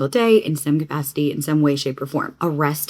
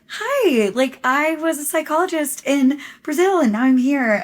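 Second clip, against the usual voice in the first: high, louder imitation of the Brazilian fan; fan's voice; 9 semitones higher, 4 dB louder than usual. The fan explaining herself, high and bright. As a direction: put on the fan's chirpy voice. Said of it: "And she's just like".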